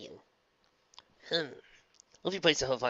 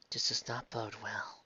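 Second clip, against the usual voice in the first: grave, quiet; 10 dB quieter, slower than usual. Acted. Celestia grave.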